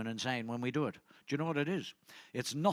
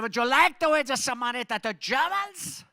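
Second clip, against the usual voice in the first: put-on high, loud German-accented question; 11 semitones higher, 9 dB louder than usual. To the crowd, a German seatmate quizzing him, German accent. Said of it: "it had a German accent".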